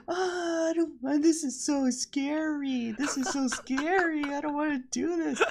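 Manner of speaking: high, whiny mock-crying child voice; crying little boy. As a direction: imitate a scared small boy wailing.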